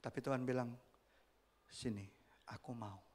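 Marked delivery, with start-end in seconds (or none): 1.7-3.0 low, soft and tender; gentle invitation. Acted. Jesus gently welcoming the leper, low and soft. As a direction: lean in and welcome him kindly.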